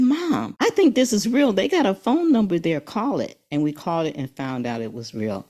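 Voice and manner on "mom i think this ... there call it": son's voice, higher and louder; son urging mom. Retold or imitated. Son telling his mom the award is real, eager and raised. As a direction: urge her eagerly to call the number. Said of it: "He said".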